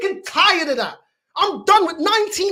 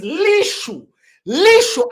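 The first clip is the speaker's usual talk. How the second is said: loud, higher burst on Lethal; outraged shout; 5 semitones higher, 9 dB louder, much slower than usual. Real, not acted. Fan ranting about his defender, loud outburst. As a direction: blurt it out in disgust.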